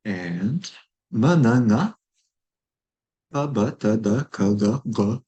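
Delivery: ma, na, pa, ba each clipped and separate; consonant demo. Shown as a demonstration. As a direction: sound out each consonant with a vowel. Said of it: "this is the combined phonemic inventory".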